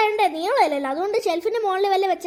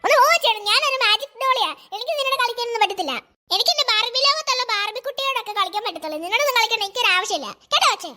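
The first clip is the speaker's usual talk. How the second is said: put-on a stuck-up doll brushing the girl off; haughty doll; 2 semitones higher than usual. Doll haughtily refusing to play with the girl.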